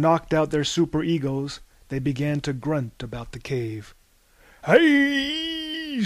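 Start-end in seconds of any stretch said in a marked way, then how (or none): 4.7-6.0 high startled yell; screamed. Acted. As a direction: scream it out, jumping up. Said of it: "screamed Harvey".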